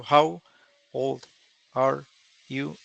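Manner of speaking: put-on "how" "old" "are" "you" split by pauses; word by word. Shown as a demonstration. To the class, showing the wrong way, split apart. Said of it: "hay cuatro cosas".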